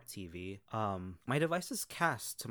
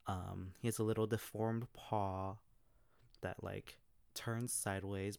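normal talking voice

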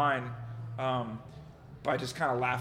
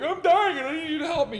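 much higher, desperate plea; panicked; 13 semitones higher, 4 dB louder than usual. Retold or imitated. The couple begging the operator, in a high panic. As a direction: beg for help in a high panic. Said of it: "they were totally panicked".

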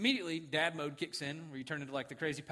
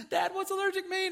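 high, loud imitated little boy; high-pitched retold child's question; worried child; 5 dB louder than usual. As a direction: imitate an anxious son asking Dad. Retold anxious son asking dad.

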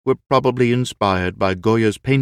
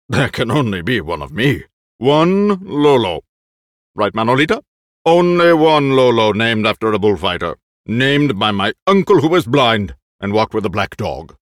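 put-on loud, boastful old actor; proud old actor; 4 semitones higher, 7 dB louder than usual. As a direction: puff up with pride.

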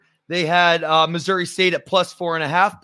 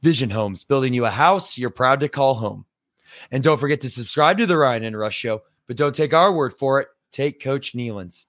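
ad read; 4 semitones lower than usual. Acted. Reads sponsor ad.

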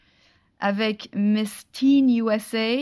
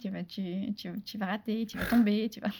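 inner demon voice; 6 dB quieter than usual. Retold or imitated. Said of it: "ce petit démon sur l'épaule qui te dit".

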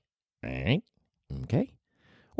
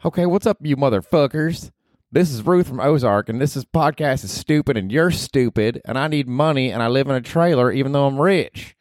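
Ruth from Ozark; 6 semitones higher than usual. Acted. Podcaster voicing Ruth, cursing at him, brash and high. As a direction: snap it like a foul-mouthed hillbilly girl.

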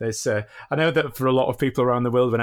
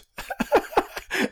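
high unvoiced laugh; breathy laugh. Real, not acted. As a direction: guest laughing at his own answer. Laughs at own answer.

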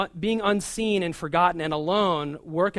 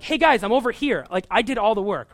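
loud, higher, abrupt demand for attention; rude outburst; 5 semitones higher, 6 dB louder than usual. Retold or imitated. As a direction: snap at the room to be noticed. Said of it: "you might just say something rudely or, or abruptly".

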